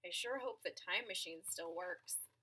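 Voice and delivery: ordinary delivery, nothing marked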